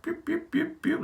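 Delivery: high unvoiced pew sounds; breathy whistling deflation; deflating noise. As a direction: let the air out of the dream. Reacting to the hotel price.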